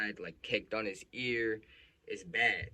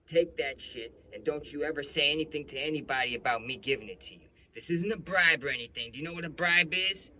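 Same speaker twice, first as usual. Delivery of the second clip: raised, louder imitation of a threatening cop; warning cop; 5 semitones higher, 8 dB louder than usual. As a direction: warn him forcefully, playing the cop. Retold cop warns him about money.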